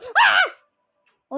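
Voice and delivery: short, high, loud "aah"; startled scream. Acted. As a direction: cry out sharply in sudden alarm.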